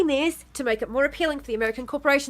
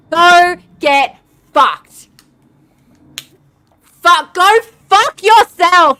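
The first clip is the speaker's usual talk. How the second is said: much higher, much louder raging yell; furious shouting; 4 semitones higher, 10 dB louder than usual. Real, not acted. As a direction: scream it at the politicians. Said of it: "I've never been more enraged".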